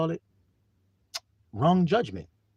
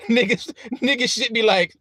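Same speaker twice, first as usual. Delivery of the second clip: laughing; 8 semitones higher than usual. Real, not acted. Mocking laugh setup.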